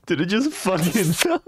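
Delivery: high, laughing disbelief; real laughing fit mid-question; helpless laughter. Real, not acted. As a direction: crack up too much to finish. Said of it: "I can't breathe".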